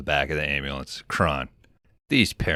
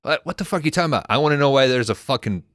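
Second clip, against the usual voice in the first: much higher and fast, a mock rant; hammed-up angry dad, fast and higher; mock furious dad; 8 semitones higher, 4 dB louder, much faster than usual. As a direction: rattle it off like an irate father. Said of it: "And he's like".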